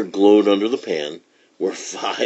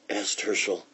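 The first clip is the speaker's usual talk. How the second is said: ordinary delivery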